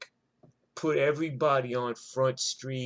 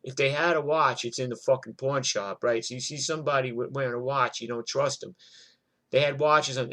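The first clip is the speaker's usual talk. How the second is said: ordinary talk, nothing marked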